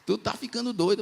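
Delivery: high, incredulous friend's voice; friend's retort. Retold or imitated. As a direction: pitch way up, mocking disbelief. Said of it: "irmão dessa pessoa aqui".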